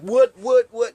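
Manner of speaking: high, loud mock tough-guy challenge. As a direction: puff up like a wannabe tough guy. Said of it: "trying to be, like, a tough guy".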